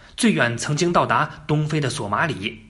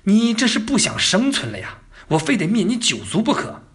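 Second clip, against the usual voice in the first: mock fury, pitched up; enraged emperor; 6 semitones higher than usual. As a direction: imitate an incensed ruler making a threat. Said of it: "朱棣呢也气疯了". (模仿气疯了的皇帝，高声威胁)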